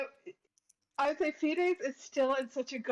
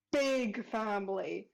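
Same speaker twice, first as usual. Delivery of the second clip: ordinary talk, nothing marked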